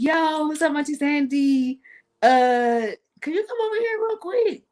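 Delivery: high, breezy mock-casual request; casual phone call. Calling her aunt for help, high and casual. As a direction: play it light, as if nothing is wrong. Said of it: "because I'm so calm when I'm".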